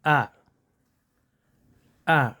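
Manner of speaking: short relaxed "आ" schwa; schwa sound. Shown as a demonstration. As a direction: say "आ" as a short, lax uh with a relaxed mouth. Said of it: "हल्का सा बस ऐसे करना".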